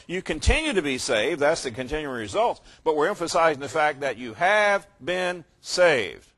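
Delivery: ordinary delivery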